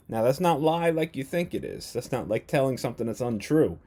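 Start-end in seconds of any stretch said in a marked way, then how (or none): none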